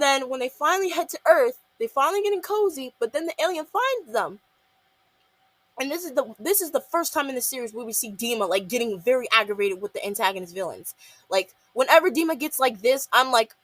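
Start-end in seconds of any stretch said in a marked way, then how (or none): none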